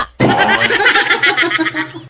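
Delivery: a burst of high giggling; light giggle; high laugh. Real, not acted. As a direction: giggle at the memory. Laughing at own story.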